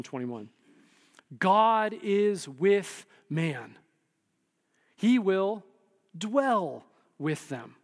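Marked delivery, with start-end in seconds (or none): 1.4-7.7 slow, weighty scripture read; solemn slow reading. Shown as a demonstration. Preacher reading Revelation to congregation, slow and solemn. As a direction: let each phrase land slowly. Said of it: "reading Revelation slowly".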